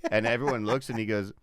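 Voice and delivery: ordinary delivery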